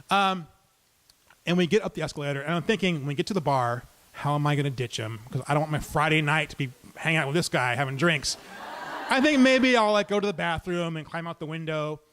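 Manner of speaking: usual speaking voice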